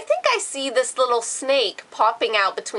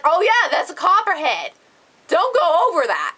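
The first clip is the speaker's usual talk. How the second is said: raised-pitch mock dad voice; a hint of a high father voice; lightly mimicked dad; 8 semitones higher than usual. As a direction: imitate dad's sudden realization, pitched up. Acting out dad spotting the snake.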